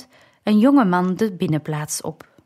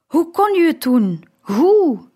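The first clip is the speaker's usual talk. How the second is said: high, raised cry of grief; anguished outcry; 7 semitones higher, 5 dB louder than usual. A narrator's reading. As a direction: cry it out in hurt reproach. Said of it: "hoorde ik het verdriet in zijn stem". (hoog, verdrietig verwijtend uitgeroepen)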